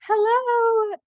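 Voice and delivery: high, sing-song hello of a lady picking up; sweet phone greeting. Retold or imitated. Psychic lady answering their call with a high sing-song hello. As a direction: answer the phone like a friendly stranger.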